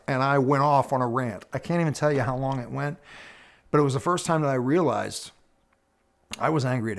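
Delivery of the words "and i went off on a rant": ordinary delivery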